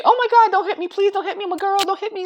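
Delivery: mocking the girls who beg not to be hit, high and whiny; mock girly plea. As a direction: mince it like a helpless girl begging. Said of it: "they say".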